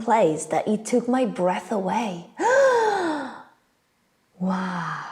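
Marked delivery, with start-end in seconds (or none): 2.3-3.3 high, hammed-up gasp of wonder; amazed gasp. Acts breath taken away. 4.4-5.0 low, soft acted wonder; awestruck. Awed by amazing place.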